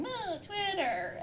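high, soft, drawn-out whine; mock whining. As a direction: whine it slowly, half-heartedly. Imitates herself whining at computer.

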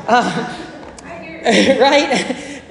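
laughing through a right?. Real, not acted. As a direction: chuckle at your own joke, then ask. Laughs at own joke.

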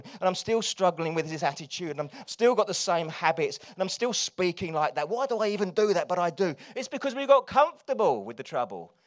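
loud, driving preacher's voice; loud preaching. Acted. Preacher warning the church about staying stuck, loud. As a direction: push the congregation hard.

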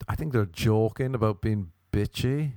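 ordinary delivery

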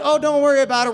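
high, loud, carefree lady voice; loud, high-pitched dismissive cheer; mock breezy reassurance. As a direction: imitate an overconfident organizer brushing it off. Voicing the vice principal's breezy reassurance.

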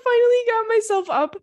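high, strained effortful squeak; straining to get up off the floor; squeaky effort voice. Retold or imitated. Retelling struggling up off floor.

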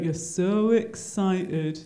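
slow, drawn-out mock observation; teasing imitation. Host imitating Dave's reaction to her excitement, slowly teasing. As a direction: stretch each word out in put-on imitation. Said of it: "Dave was just like".

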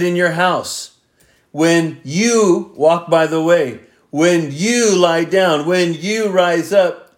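every "You" and "your" leaned on and lengthened; stress on YOU, YOUR. Shown as a demonstration. Preacher rereads the verses to the congregation, stressing "you" and "your". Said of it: "let me emphasize the words you".

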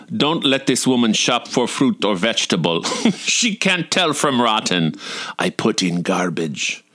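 lightly voiced Russian waitress, matter-of-fact; dry Russian accent verdict. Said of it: "forgive my Russian accent".

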